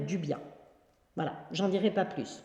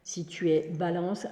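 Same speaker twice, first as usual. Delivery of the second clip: ordinary talk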